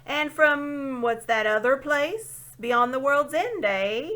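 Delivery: put-on a deaf old dwarf shouting his grievance; shouting old dwarf who can't hear; loud old dwarf. A narrator's reading.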